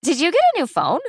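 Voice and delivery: a daughter prying at mom's secret; curious girl. Acted. Daughter asks about new phone.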